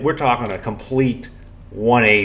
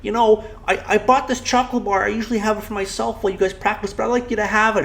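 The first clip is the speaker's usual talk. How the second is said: put-on high, frail old lady voice; 9 semitones higher than usual. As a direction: play a sweet elderly woman. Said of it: "in her old lady voice".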